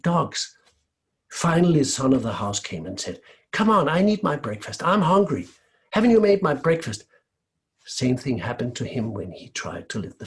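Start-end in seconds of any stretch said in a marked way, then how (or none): 3.5-7.0 higher, louder petulant demand; whiny demand. Acted. Spoiled son complaining to the kitchen about breakfast, whiny.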